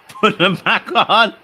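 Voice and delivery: high, loud words through laughter; can't stop laughing while talking. Real, not acted. Laughing at guest's bad mic.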